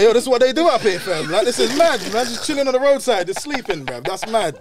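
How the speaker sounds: amazed shout about the sleeper; hyped-up quote. Retold or imitated. To his friend, retelling the sight, loud and amazed. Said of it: "And I was like".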